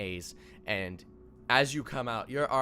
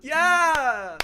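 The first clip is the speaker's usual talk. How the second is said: high, loud drawn-out cheer; one stretched, uncertain hurrah; awkward crowd cheer; 12 dB louder than usual. Acted. Crowd greeting the god of lies, awkward cheer. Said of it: "everyone seems like, like, everyone's like".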